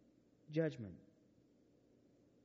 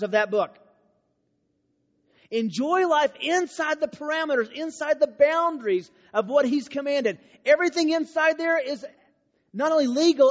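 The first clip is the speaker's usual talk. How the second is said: higher and louder, driving every phrase; full-force preaching; 8 semitones higher, 7 dB louder than usual. Acted. Telling the students God is not baiting them, loud preaching. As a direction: preach it with fire.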